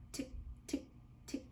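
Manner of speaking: whispered tick tick; unvoiced ticking clock noise. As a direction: click it out like a clock. Clock sound imitated.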